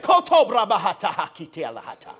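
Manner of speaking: loud rapid-fire syllables of tongues; praying in tongues. Retold or imitated. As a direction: retell a burst of prayer in tongues. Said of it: "We were praying in tongues".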